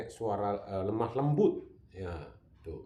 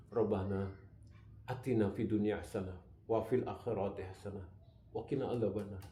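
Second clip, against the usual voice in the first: heartfelt prayer; 5 semitones lower, 6 dB quieter than usual. Shown as a demonstration. Preacher showing how a prayer should really be said, heartfelt. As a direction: pray it as if you mean every word. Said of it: "Harusnya kan".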